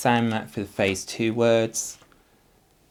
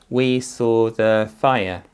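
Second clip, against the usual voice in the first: loud, slow, word by word; slow read-back; 7 dB louder, slower than usual. Shown as a demonstration. Teacher testing where the full stop goes, slow and loud. Teacher reading sentence to check.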